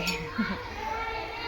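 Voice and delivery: a short quiet laugh; soft laugh. Real, not acted. Laughing at her husband's quip, softly.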